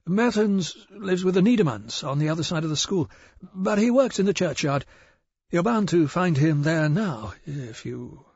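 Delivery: a jittery village shopkeeper eager to please; nervous shopkeeper. Acted. Narrator voices nervous shopkeeper.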